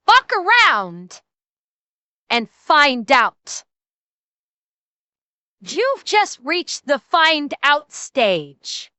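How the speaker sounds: very slow, drawn-out words; slow menace. Pretend. Warning the gamer slowly and drawn out. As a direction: lay out each word like a threat.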